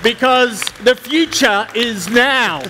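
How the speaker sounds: slow, grand tech keynote declaration; grand keynote line. Pretend. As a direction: announce it like a visionary on stage. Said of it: "I've always wanted to say that at a tech conference".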